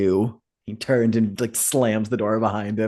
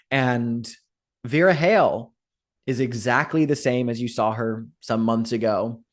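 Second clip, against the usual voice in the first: normal talking voice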